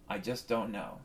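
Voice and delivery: a resigned, quiet I just don't know; deflated mumble. Acted. Comic to the crowd, a defeated low mumble. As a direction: drop it low and soft, giving up.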